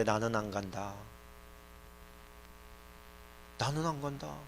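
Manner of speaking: soft refusal with a long pause; quietly refusing God. Acted. God tells Moses He is not going, quietly. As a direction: refuse quietly and let it hang. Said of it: "하나님께서 그렇게 얘기해요".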